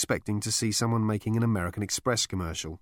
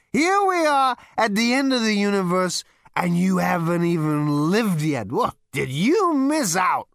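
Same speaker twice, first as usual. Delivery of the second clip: high, loud, brash Zaphod; boastful high party voice; 10 semitones higher, 6 dB louder, slower than usual. A narrator's reading.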